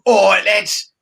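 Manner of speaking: mock cockney geezer showing off; Danny Dyer impression. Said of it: "as Danny Dyer".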